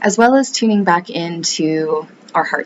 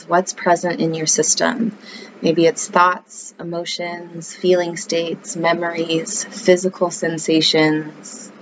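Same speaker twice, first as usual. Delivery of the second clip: calm yoga-teacher voice; guided meditation. Acted. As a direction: ease the listener into the breath, unhurried.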